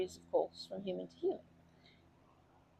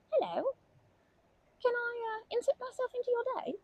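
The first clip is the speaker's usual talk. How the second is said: much higher, sing-song hello; chirpy greeting; 14 semitones higher than usual. Retold or imitated. Grandma replaying how she greeted the duckling, high chirpy hello. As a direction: pipe up brightly to a stranger. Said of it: "was like".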